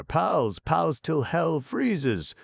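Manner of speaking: high, slow, heartfelt movie-title cry; moved cry. A narrator's reading. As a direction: declaim it like a silent-film caption. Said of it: "cried Freddy, deeply moved".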